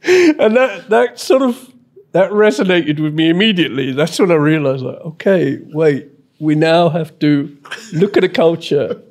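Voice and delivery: amused, laughing aloud. Real, not acted. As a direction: laugh as you tell it. Laughing while telling.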